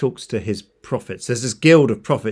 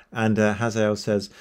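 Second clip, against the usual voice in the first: plain reading voice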